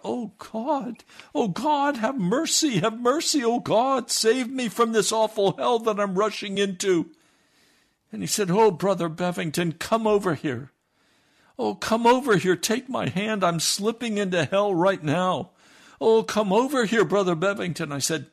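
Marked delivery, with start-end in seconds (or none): 0.0-7.2 much higher, louder wailing to God; anguished crying out. Retold or imitated. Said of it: "he burst out". 8.5-17.9 higher, louder begging; frantic pleading. Retold or imitated. Man begs preacher to come.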